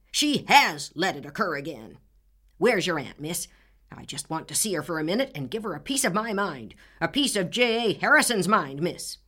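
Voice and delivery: put-on angry old man. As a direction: bellow the grievance. Furious neighbor berates Anne.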